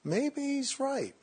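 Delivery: slow, high-pitched mock pondering; half-convinced. Meditator starting to side with self-talk, tentative wondering. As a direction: draw it out high, as if tempted to agree. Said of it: "when you start to say".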